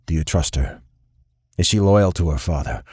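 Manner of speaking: low, measured voice of a dangerous crime boss; cold boss sizing up the prisoner; stern boss. A narrator's reading. Crime boss questions Nikolai about his fiancée, low and cold.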